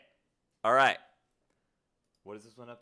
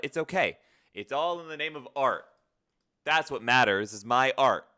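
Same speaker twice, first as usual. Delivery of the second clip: loud, raised-pitch proclamation; mock-grand declaration; 5 semitones higher, 14 dB louder than usual. Streamer suffering for the name of art, mock-grand declaration.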